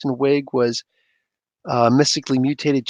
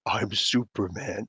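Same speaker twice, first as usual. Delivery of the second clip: hushed, slow quote; 6 semitones lower, 10 dB quieter, slower than usual. Retold or imitated. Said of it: "without at the very end".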